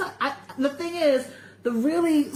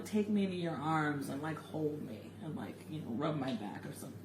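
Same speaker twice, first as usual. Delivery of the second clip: soft pleading; 10 semitones lower, 14 dB quieter than usual. Real, not acted. Asks for tender comfort.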